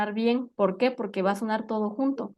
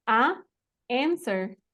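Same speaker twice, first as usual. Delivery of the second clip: "a answer" with a held "a", then a pause; pausa entre vocales; much slower than usual. Shown as a demonstration. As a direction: stop after "a" before starting "answer". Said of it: "Lo mismo". ("a answer" separado por una pausa)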